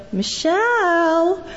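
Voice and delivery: high, slow drawn-out call of the name. Retold or imitated. Kay calling Michelle from the kitchen, high drawn-out call. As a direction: stretch the name out high, calling across the house.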